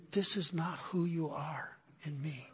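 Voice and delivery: soft unvoiced whisper; whispered quote. Friend quoting God's words to the preacher, soft whisper. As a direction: hush it like a secret revealed. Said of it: "he said to me".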